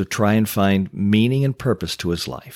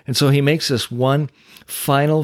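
ordinary talk, nothing marked

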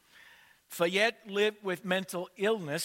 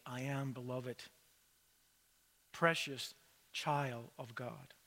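hushed mirror words; 5 semitones lower, 9 dB quieter than usual. Retold or imitated. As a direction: barely voice it to yourself. Looking in mirror, bloodied.

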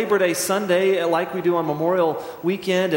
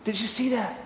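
put-on high, soft childlike wonder; a boy stunned in the cinema; small boy asking his dad, high and hushed in awe; awed kid; 5 semitones higher, 5 dB quieter, slower than usual. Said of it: "I'm sitting there in shock, going".

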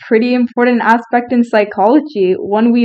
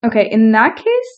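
ordinary talk, nothing marked

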